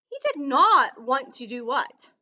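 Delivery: ordinary delivery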